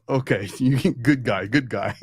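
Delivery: chuckling broken-English praise; laughing priest. Retold or imitated. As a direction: laugh through it like an amused priest. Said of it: "He was smiling and laughing".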